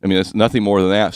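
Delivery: ordinary talk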